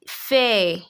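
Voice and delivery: "Fait" said as feh, final consonant silent; French open E. Shown as a demonstration. Teacher models the word for learners, careful French pronunciation. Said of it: "gives it an E sound".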